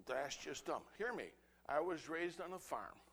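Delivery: ordinary delivery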